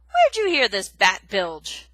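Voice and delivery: loud, slow scornful demand. Acted. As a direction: sneer the question. Aerith scornful.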